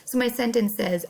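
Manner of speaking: usual speaking voice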